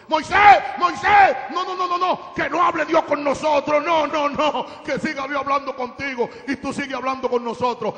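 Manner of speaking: high, very loud crowd outcry; acted panicked shouting. Terrified Israelites beg Moses that God not speak, acted shouting. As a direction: shout frantic refusals, as if begging. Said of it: "Y esos sinvergüenzas gritaron".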